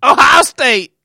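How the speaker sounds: shouted Ohio cheer; fan shout. Pretend. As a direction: belt out the school name like a fan.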